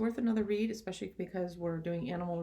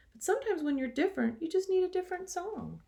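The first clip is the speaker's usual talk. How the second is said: higher, faster little cricket voice; 11 semitones higher, 4 dB louder than usual. Acted. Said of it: "coughed a cricket".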